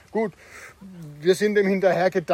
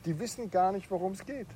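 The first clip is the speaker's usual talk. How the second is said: ordinary talk, nothing marked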